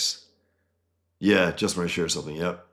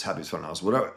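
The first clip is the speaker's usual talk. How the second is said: usual speaking voice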